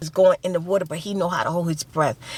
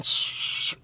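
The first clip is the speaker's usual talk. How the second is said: whispered starter hiss; the jet ski failing to start, hissed; imitated engine sputter. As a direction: hiss like a motor that won't catch. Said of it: "He kept on".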